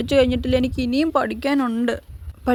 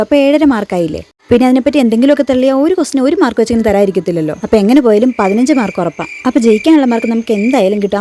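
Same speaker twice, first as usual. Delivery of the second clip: louder, boastful reassurance to the gang; loud pep talk; 8 dB louder than usual. Acted. Student hyping up classmates before the exam, loud and boastful. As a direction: pump up your nervous friends, loudly.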